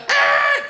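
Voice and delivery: short, loud high shriek; sudden high "ah" of outrage; imitated angry yell. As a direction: blurt a mock-enraged screech. Imitates the enraged driver's noise.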